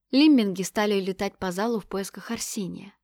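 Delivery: plain reading voice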